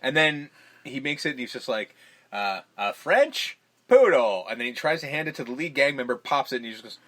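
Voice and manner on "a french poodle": slow, louder mock showman reveal; showy announcement. Hero presenting a balloon animal to the gang, slow showy reveal.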